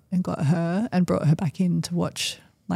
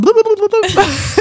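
high, loud playful bloops; mock unwinding noise; 7 dB louder than usual. Husband miming the cord unwinding with high bloops. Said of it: "and he's gone".

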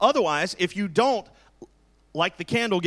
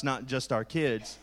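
ordinary delivery

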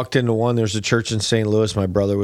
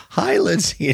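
lightly acted higher-pitched hello; party guest greeting; 7 semitones higher, slower than usual. Guest greeting the toddler at the party, lightly imitated high greeting. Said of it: "They go".

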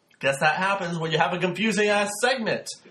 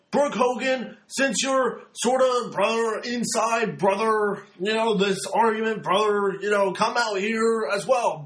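higher, slow rambling promo voice that stumbles over names; Hulk Hogan impression; 3 semitones higher than usual. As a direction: drag out brother after brother like Hogan. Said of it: "using way too many words, basically stumbling".

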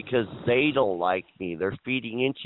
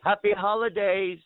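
higher-pitched mock holiday greeting; mimicked cheery greeting; 7 semitones higher than usual. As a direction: imitate people walking in with a greeting. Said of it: "People walk in".

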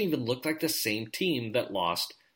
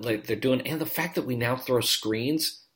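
normal talking voice

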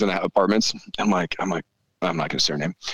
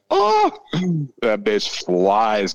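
excited; 2 semitones higher, much slower than usual. Real, not acted. Raving about new dirt bike.

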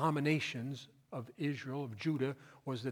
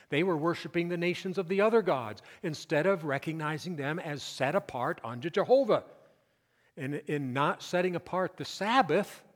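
louder, higher preaching build; fervent preaching; 3 semitones higher, 7 dB louder than usual. Acted. Pastor exhorting congregation about the Sabbath, loud preaching. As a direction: drive the point home from the pulpit.

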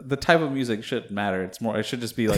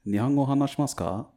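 higher-pitched spoken Japanese; careful Japanese pronunciation; Japanese accent; 4 semitones higher, faster than usual. Shown as a demonstration. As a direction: ask politely with a Japanese accent. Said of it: "speak some Japanese".

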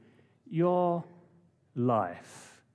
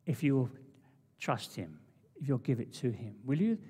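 ordinary talk, nothing marked

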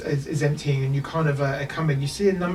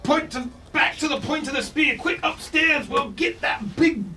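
gruff dwarf; 10 semitones higher than usual. Acted. Dwarf captain rallies his men up the stairs, high and shouting. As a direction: bellow orders like a battle-mad dwarf. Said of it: "a dwarvish voice resonating".

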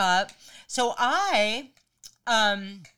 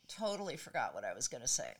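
softer, voice fading out; trailing off; 7 semitones lower, 12 dB quieter, faster than usual. Real, not acted. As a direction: let it trail away, lost in thought. Forgets mid-story, voice dropping away.

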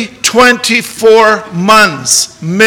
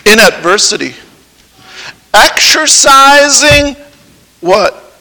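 slow, louder deliberate intoning; solemn vow reading; 3 semitones higher, 4 dB louder than usual. Acted. Preacher reading the covenant to the groom, slow. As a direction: weigh every word of the vow.

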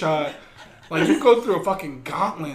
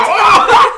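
loud, high yelp through laughter; imitated yelp at a needle jab; mock pained cry; 13 semitones higher, 12 dB louder than usual. Retold cry as needle goes in.

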